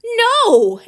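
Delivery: high, loud sharp yelp. A narrator's reading. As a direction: cut him off with a yelp. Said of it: "Antony yelped".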